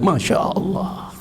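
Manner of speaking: slow, soft whispered Masya Allah; whispered awe. Real, not acted. Preacher whispering awe to the congregation. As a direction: let it out as a hushed marvel.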